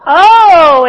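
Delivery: high, loud stifled-laugh "Oh"; surprised exclaim. Acted. Ginger the cat reacting to the glowing bottom, loud surprised exclaim. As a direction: burst out, holding back a laugh. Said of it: "exclaimed Ginger, trying so very hard not to laugh".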